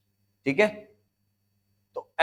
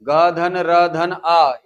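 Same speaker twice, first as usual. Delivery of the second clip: slow spelling out; 4 dB louder, much slower than usual. Shown as a demonstration. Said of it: "उच्चारण करो".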